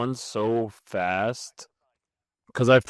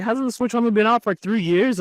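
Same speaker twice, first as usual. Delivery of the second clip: surprised question; 9 semitones higher, 3 dB louder than usual. Retold or imitated. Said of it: "I was like".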